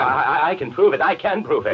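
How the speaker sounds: high, laughing, desperate stammer; cornered man pleading with a nervous laugh; nervous insistence. Acted. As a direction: grab at any proof, half laughing. Suspect desperately insists on proof.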